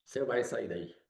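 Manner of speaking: soft, low, calm order; Jesus speaking softly to death, calm authority; quiet command. Acted. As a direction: tell death quietly it must leave. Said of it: "Fala assim".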